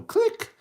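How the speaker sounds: high, sharp "click"; lock click. Acted. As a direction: pop out a loud, high click. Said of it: "and they go".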